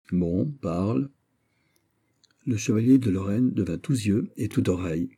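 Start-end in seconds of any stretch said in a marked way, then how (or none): 0.1-1.1 slow, lordly prince; condescending prince. A narrator's reading. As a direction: grant leave from above. Prince grants permission. (prince hautain, lent)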